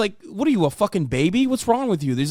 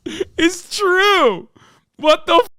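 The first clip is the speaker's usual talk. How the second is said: high, disbelieving mock squeal; squeaky scoffing at the clip; incredulous mockery; 9 semitones higher than usual. Real, not acted. Mocking the clip, pitched way up.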